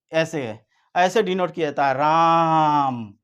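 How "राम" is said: राम stretched very long on the आ; drawn-out राम. Shown as a demonstration. Teacher demonstrating a prolonged vowel, drawn-out राम. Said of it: "ये हो गया राम".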